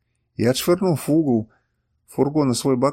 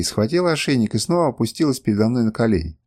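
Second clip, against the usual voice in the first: plain reading voice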